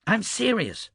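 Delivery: sharp, high-pitched retort; snapped. A narrator's reading. Vlora cuts off her former lover's chiding, snapping. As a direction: snap it back, pitch leaping up. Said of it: "she snapped".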